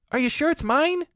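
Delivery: very high, loud excited squeak; high squeaky piglet. A narrator's reading. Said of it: "squeaked Piglet excitedly".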